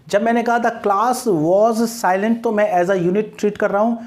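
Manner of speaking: ordinary talk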